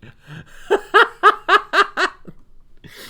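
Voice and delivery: big high burst of laughter; loud, high-pitched laughter. Real, not acted. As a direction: crack up loudly.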